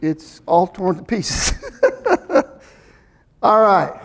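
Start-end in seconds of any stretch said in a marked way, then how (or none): 1.6-2.4 quick high-pitched chuckle; a bright burst of laughter. Real, not acted. Preacher laughing at his torn Bible, high chuckle.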